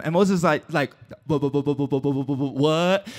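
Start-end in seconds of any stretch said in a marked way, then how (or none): 1.3-3.1 low, soft stammer on "wha", ending in "what?"; mock stutter on "wha", low and quiet. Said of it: "He had a stuttering problem".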